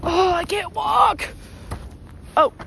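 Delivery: high, loud, slow cry of frustration; yelling as the snow swallows her legs; real frustrated shout. Real, not acted. Stumbling in deep snow.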